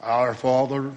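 a hint of a solemn churchy voice; mock pious prayer voice. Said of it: "a different tone of voice".

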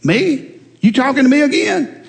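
high, incredulous rising "Me?"; disbelieving customer. Acted. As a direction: act out the man's startled disbelief. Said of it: "And the man looked".

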